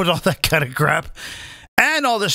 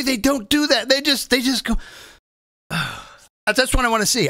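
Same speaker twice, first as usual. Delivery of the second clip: usual speaking voice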